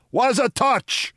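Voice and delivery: high, slow foreign henchman; high-pitched, baffled henchman; demanding. A narrator's reading. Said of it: "demanded Egypt".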